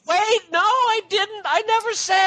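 very high, hammed-up denial; mock-panicked protest. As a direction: squeal an objection as if frantic. Imagining Steve's protesting email.

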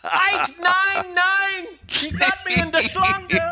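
high, loud, hammed-up cry of pain; mock German soldier crying out. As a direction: wail like a bitten soldier. Mock German war movie.

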